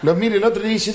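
ordinary talk

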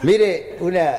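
ordinary delivery

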